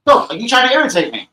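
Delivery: high, loud cry of exasperation; exasperated shout. Real, not acted. Streamer shouting at his pet for irritating him, exasperated. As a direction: yell it, really irritated.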